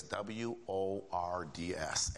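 each letter W O R D S said slowly and apart; spelled out. Shown as a demonstration. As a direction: drop the letters W O R D S one by one, slowly.

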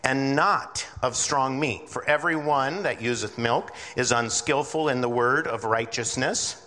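ordinary talk, nothing marked

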